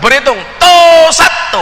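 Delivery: high, slow shouted Madurese count-off; a Madurese recruit fumbling the count-off; slow Madurese drill count. Retold or imitated. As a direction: drag out the command in a thick Madurese accent. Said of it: "Madura nggak bisa".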